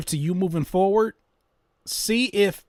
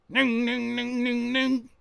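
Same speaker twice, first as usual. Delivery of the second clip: synth imitation; 9 semitones higher, slower than usual. Imitating a cheap synth sound.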